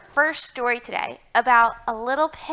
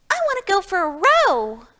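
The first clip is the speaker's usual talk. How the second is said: high, fast excited piglet voice; Small Pig begging to row, high and eager; eager little pig; 9 semitones higher, 4 dB louder, faster than usual. Acted. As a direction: bounce it like a dancing little pig. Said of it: "dancing about".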